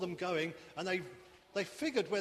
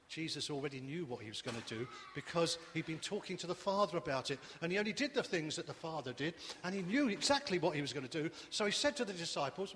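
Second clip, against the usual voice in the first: usual speaking voice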